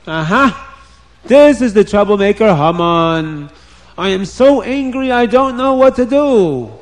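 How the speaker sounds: storybook king working up his anger; angry king. A narrator's reading. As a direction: accuse him like an outraged king. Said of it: "the king got very angry at Haman".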